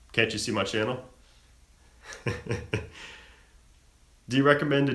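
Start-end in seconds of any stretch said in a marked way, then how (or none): 2.1-3.0 quiet breathy chuckle; soft laugh. Real, not acted. Streamer amused while reading chat, soft laugh. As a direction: chuckle softly to yourself.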